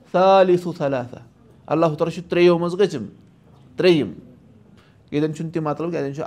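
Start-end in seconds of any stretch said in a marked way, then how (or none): 0.1-1.1 slow, melodic Quran recitation; Quranic recitation. Acted. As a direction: chant the verse slowly.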